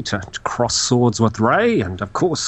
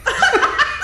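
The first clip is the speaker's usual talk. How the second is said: laughing. Real, not acted. Burst of laughter.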